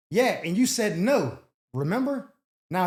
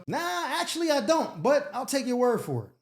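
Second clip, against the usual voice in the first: sarcastic brush-off; 5 semitones higher than usual. Real, not acted. Cohost snubs caller's "you know?.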